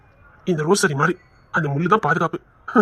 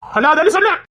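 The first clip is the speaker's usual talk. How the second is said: higher, louder stern order; firm command; 8 semitones higher, 3 dB louder than usual. Acted. Husband telling his crying wife to stop, firm and loud. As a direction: snap at her to stop crying.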